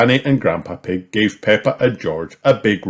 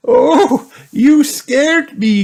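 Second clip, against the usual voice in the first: high, loud playful fright; mock scared; 7 dB louder, much slower than usual. A narrator's reading. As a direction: pretend to be scared. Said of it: "said Granny Pig".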